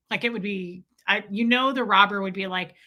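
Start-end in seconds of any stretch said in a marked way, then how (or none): none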